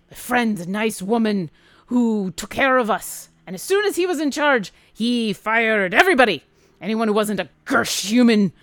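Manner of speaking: loud, gruff dwarf storyteller with a grudge; aggrieved gruff dwarf. Acted. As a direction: air a bitter grievance as the dwarf. Dwarf tells his grievance.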